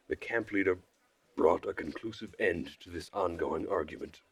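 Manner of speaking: ordinary delivery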